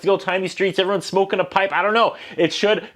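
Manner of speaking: usual speaking voice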